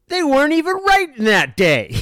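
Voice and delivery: high, loud, laughing exclamation; hammed-up outrage. Sportswriter imitating his friend's gripe about the columnist, hammed-up outrage. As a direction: cry out the punchline in mock disbelief. Acted punchline of colleague's outraged story.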